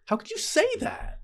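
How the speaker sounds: very high-pitched, incredulous squeal; mock outraged protest. Acting out a reply in the lovers' quarrel, squeaky outrage. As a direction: act out indignation leaping into high register. Same retort repeated, far higher.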